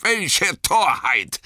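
lightly voiced Boromir arguing heatedly; eindringlicher Boromir. A narrator's reading. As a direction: press the point, rising to scornful exasperation. (eindringlich, zunehmend empört)

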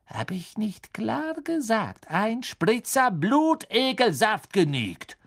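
hohe, gedehnte Empörung; aufgebrachte Rüge. A narrator's reading. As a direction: langsam jedes Wort betonen, gegen Ende lauter werden. Snape steigert die Rüge. (empört, hoch und gedehnt)

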